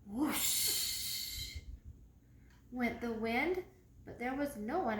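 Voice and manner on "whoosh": plain reading voice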